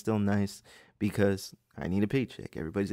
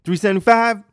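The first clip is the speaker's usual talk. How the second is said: very high, blaring incredulous echo; loud obnoxious customer; 11 semitones higher, 12 dB louder than usual. Retold or imitated. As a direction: shout the price back in disbelief. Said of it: "be that loud and obnoxious".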